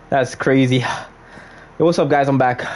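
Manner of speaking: usual speaking voice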